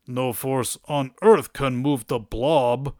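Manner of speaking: slow, put-on cartoon giant's voice; slow boast of an immovable giant, hammed up; Blob. Giant villain blocking Nightcrawler's path, slow boastful voice. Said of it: "Blob just says".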